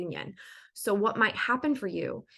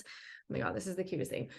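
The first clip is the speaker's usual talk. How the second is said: much softer, hushed and adoring; tender aside; 3 semitones lower, 11 dB quieter than usual. Real, not acted. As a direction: melt over something cute. Gushing over a child's emotions.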